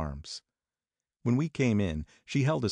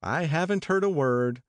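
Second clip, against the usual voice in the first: put-on higher, wry young woman; Jordan calling down; 9 semitones higher, 3 dB louder than usual. A narrator's reading. Jordan voiced, high.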